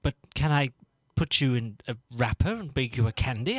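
ordinary delivery

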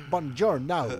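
loud, drawn-out Italian greeting; bad Italian accent. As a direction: ham up the Italian. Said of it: "nothing better than that".

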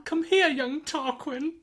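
high, slow mock-mother call; yelling child's full name. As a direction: call the child in like a scolding mum. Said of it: "that's me doing your voice".

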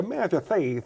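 lisping, mannered boxer impression; Chris Eubank. Impressionist showing his way into the boxer, lisping impression. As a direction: slip into the boxer's lisped delivery. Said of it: "So I'd always go".

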